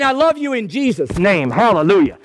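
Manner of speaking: "I love you in Jesus' name" said warmly and loud, Hallelujah ringing out; mean it fully, embrace him in words; heartfelt Jesus hug. Retold or imitated. Preacher showing a true brotherly greeting, heartfelt and loud. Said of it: "I call it a Jesus hug".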